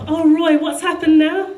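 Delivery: retold cry of fond dismay; mock concern. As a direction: re-enact rushing in to help a friend. Acts out rushing to help.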